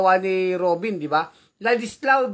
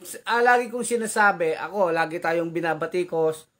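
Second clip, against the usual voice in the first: ordinary talk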